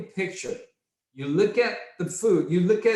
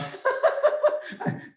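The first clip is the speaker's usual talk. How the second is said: laugh. Real, not acted. Laughs at juicy fish.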